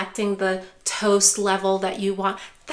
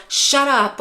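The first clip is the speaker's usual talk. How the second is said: loud, sharp shut-up; angry snap; 3 semitones higher, 6 dB louder than usual. Retold or imitated. Parent snaps at caregiver, loud angry retort. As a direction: bite the words off at the caregiver. Loved one lashing out, quoted.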